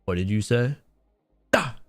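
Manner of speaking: ordinary talk, nothing marked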